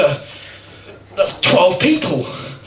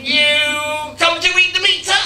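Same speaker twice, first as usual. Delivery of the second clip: high, drawn-out Scottish mum voice; crazy Scottish mum; 6 semitones higher, slower than usual. Acted. Confused mum not recognising her child at the door, high Scottish voice. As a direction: slowly demand it as a confused Scottish mother. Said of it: "she's Scottish".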